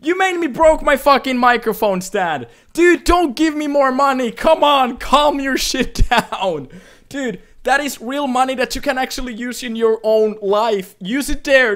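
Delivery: high, loud, worked-up yell; exasperated yelling. Real, not acted. Streamer scolding generous donor over broken mic stand, yelling. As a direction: shout in exasperated protest, easing slightly.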